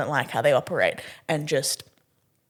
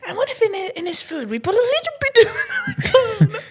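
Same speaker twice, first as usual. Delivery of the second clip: much higher, conspiratorial glee; high mischievous scheming; giddy plotting; 3 dB louder than usual. Retold or imitated. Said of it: "And I'm like".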